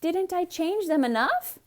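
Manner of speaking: innocent Amelia. A narrator's reading. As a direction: ask sweetly. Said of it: "asked Amelia Bedelia".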